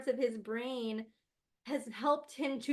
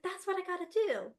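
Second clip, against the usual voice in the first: put-on child voice; 8 semitones higher than usual. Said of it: "He's like".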